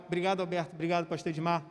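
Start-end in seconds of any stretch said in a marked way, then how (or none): none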